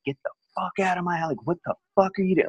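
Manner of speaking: high, strained mock yell at the guy; reliving telling him to get out, voice jumping high; high-pitched yell of a furious nineteen-year-old; retold yelling. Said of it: "So I just started yelling at him".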